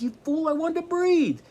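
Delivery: high, loud, indignant retort; exasperated outcry. Retold or imitated. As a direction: fling the obvious answer back at him. Man answering what he wanted.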